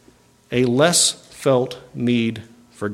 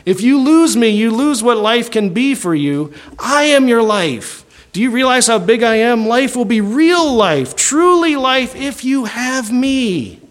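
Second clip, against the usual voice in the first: high, slow voice of money tempting a man; the inheritance's own voice calling to the man in the crowd, high and slow; money talking; 8 semitones higher than usual. Retold or imitated. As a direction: coax slowly and high, as the money itself. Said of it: "He said it was saying".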